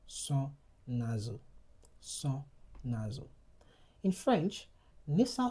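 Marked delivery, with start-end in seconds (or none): 0.1-1.4 son nasal with a nasal on; French nasal vowel. Shown as a demonstration. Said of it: "talking about French nasal sounds". 2.0-3.3 son nasal, a slow falling repeat; French nasal vowel. Shown as a demonstration. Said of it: "talking about French nasal sounds".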